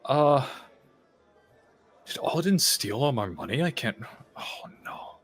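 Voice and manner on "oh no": breathy whisper of dismay. Real, not acted. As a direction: whisper it, crushed. Realizes money stolen.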